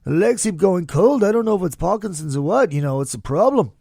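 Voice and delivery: deliberately bad [name], a hint of his voice; worst [name] voice. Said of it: "in my worst [name] voice possible".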